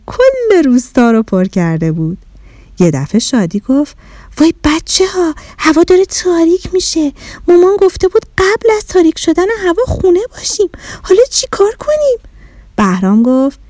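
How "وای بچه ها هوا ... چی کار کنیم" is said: put-on high, hurried child's worry; worried girl. Shadi warning the kids they must get home, high and hurried. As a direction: panic a little, words rushing and climbing. Shadi alarmed it is getting dark.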